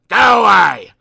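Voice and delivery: loud, angry yell; angry shout. Acted. Mister Grumpy yelling at Mister Happy to leave. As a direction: bellow him off.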